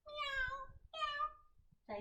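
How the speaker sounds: put-on high cat meow for the children. Shown as a demonstration. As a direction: mew like a playful cat. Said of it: "Arch your back".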